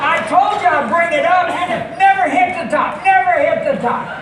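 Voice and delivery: razzing the guy who missed the trash can, shouting; mocking shout. Real, not acted. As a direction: yell it at him, laughing. Heckling the audience member's throw.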